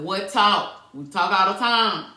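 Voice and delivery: loud, emphatic challenge; acted objection. Boyfriend disputing that they had that talk, loud acted objection. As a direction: push back loudly, as if challenged. Acting out his puzzled objection.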